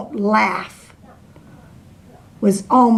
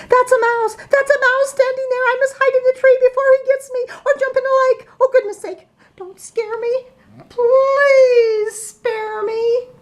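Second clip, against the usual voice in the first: very high, fast panic; panicked elephant. Acted. The elephant flees the mouse in high panic. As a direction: babble in terror, pitched way up.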